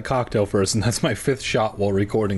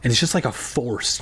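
ordinary delivery

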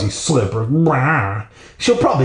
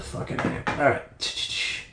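whispered swearing; swearing under his breath at the fallen shampoo; muttered curse; 12 dB quieter, slower than usual. Real, not acted. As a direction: hiss it under your breath. Shampoo fell, swearing aside.

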